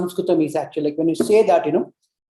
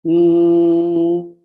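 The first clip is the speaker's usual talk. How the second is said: long steady hum with closed lips; humming sound; 5 dB louder than usual. Shown as a demonstration. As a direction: hum mm in the mouth, not the throat. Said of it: "like, humming sound".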